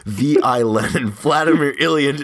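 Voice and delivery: mildly irritated outburst, rising in pitch towards the end; mock angry snap. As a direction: imitate someone finally losing patience, half-hearted. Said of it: "he finally just snaps".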